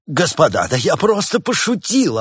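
high, loud backpedalling; flustered self-justification; defensive excuse. A narrator's reading. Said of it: "оправдывался доктор".

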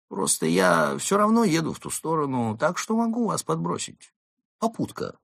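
high-pitched character voice, offhand offer; casual volunteer driver. Acted. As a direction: offer a lift as if it's nothing. Narrator voices Марио offering a lift. (высоким голосом, как бы невзначай)

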